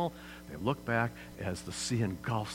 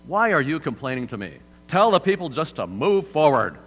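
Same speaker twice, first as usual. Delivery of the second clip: God impatient; 4 semitones higher, 8 dB louder than usual. Retold or imitated. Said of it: "He says".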